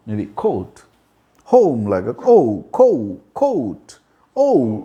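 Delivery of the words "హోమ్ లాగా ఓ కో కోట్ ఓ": ఓ gliding from O towards "oo", as in "home". Shown as a demonstration. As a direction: model కోట్ with a clear "oh-oo" glide. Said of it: "ఓ, glide రావాలి".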